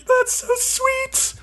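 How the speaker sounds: very high, drawn-out squeal; mock gushing. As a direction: imitate someone melting with emotion. Retold gushing over a sad story.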